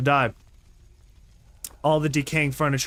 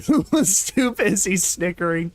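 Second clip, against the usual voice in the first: laughing; 3 semitones higher than usual. Real, not acted. Narrator breaking into laughter.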